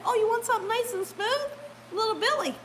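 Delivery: high, singsong coaxing imitation; sweet-talking stranger. Lady overheard them behind her, put-on high coaxing. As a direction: mimic someone innocently talking up a ride. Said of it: "That's how she was talking".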